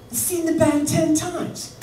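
high, slow, half-sung announcement; mock sing-song. [name] telling [name] about [name] in a sing-song. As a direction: sing it out like big news.

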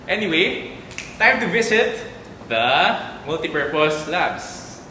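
high, loud tour-host call; showy announcement. Pretend. Host announcing the tour's next stop, showy. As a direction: build it up, then stretch out the destination.

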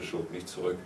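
ordinary delivery, nothing marked

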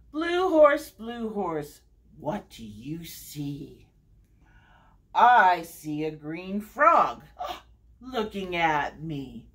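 sing-song read-aloud, each repeated name stepping down; story time refrain with falling line ends; picture-book reading. Acted. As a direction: read to little ones with lilting, measured phrasing. Reads picture book to children.

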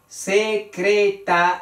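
"secretaría" broken into se-cre-ta-rí-a; sílaba por sílaba. Shown as a demonstration. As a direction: count out five separate syllables in "secretaría". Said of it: "que tiene cinco sílabas". ("secretaría" separada en cinco sílabas, con la I fuerte)

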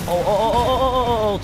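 rising excited oh, oh; yelping through a near miss; excited yelling. Real, not acted. Near miss at high speed.

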